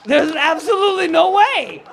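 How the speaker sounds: loud, high, drawn-out incredulity; shouted disbelief. Real, not acted. Doubting a heckler has a boyfriend, shouted disbelief. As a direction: holler it slowly, utterly incredulous. Escalating disbelief at audience member.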